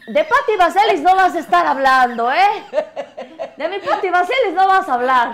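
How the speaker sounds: mock protective scold.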